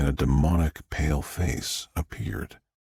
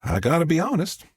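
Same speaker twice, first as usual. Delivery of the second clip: high-pitched Southern guest; lightly voiced Southern woman; 10 semitones higher than usual. Guest at the dining table, higher Southern voice.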